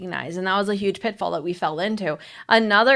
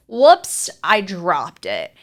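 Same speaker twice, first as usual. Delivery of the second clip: sarcastic; 2 semitones lower, 4 dB louder, much slower than usual. Acted. Wife pretending she whacked him by accident, sarcastic. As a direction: feign an accident with obvious sarcasm. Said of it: "probably about that sarcastic".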